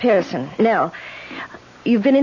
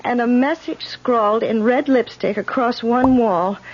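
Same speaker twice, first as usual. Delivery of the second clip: usual speaking voice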